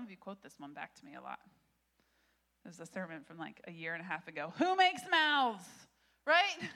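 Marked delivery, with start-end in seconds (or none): 4.6-5.6 God's retort. Acted. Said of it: "do you remember God's response".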